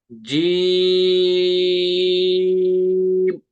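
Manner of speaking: "जीम" held very long, for three counts; three alif stretch. Shown as a demonstration. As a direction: draw "जीम" out slowly and steadily. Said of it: "इनको तीन हो-, अलिफ तक खींचते हैं". ("जीम" को तीन अलिफ तक खींचें)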